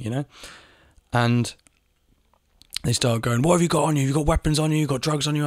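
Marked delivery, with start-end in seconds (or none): 3.4-5.5 higher, accusing mock interrogation; mock police shouting. Police demanding to know what he carries, mock shouting. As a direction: fire accusations at a suspect. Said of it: "shouting at me".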